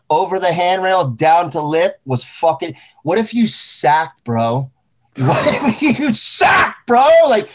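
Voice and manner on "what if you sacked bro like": high, slow, cracking up mid-question; half-laughing, half-yelled warning; laughing shout. Real, not acted. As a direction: yell it while laughing at the thought. Repeated while laughing and shouting.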